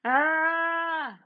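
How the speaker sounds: witch's scream. Acted. Witch pushed into oven.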